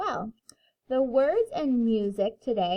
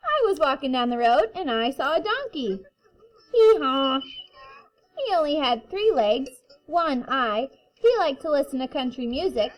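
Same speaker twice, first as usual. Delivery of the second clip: put-on reading to children; 4 semitones higher, 6 dB louder than usual. A narrator's reading. Reading picture book to kindergarten.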